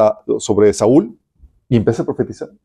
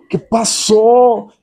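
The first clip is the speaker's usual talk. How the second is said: drawn-out, higher-pitched bafflement; baffled question; 7 semitones higher, much slower than usual. Retold or imitated. Preacher recalling his reaction to a backslider, baffled. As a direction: stretch it out in acted disbelief.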